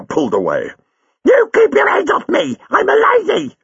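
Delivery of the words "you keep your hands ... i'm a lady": high, loud indignant shriek; a lady's outraged scream. Acted. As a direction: shriek it at the man gripping her arm. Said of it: "she screamed".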